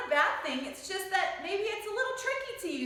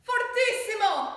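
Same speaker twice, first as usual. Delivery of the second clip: higher, louder and slow; very loud fortissimo; 8 semitones higher, 5 dB louder than usual. Shown as a demonstration. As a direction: belt the word out big and strong. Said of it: "Say this one".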